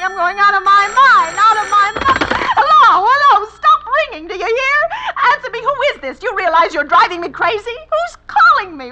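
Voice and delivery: high, loud, breaking with manic laughs; a terrified woman screaming at the phone to stop ringing; hysterical screaming. Acted. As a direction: scream at the phone, losing control.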